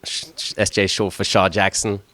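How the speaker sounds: normal talking voice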